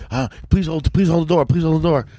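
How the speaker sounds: imitating a desperate late passenger, pitched high; acted frantic plea. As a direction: beg them in a mock panic, high-pitched. Acts out man running for flight.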